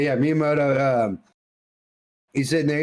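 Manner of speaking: usual speaking voice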